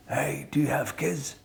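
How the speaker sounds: lightly imitated Al Pacino. Star to fellow actor in a helicopter, lightly imitated and soft. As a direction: break a silence with a soft, casual opener. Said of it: "he was like this".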